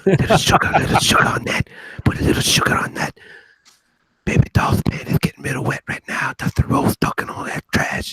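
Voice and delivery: mock ASMR whisper. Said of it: "You got the whisper".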